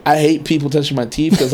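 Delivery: ordinary talk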